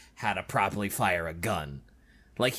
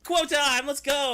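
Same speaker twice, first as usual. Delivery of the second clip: much higher, excited cheer; hyped; 4 dB louder, slower than usual. Real, not acted. To her co-host, an excited, much higher hype call. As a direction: hype up the segment. Hyping the quote segment.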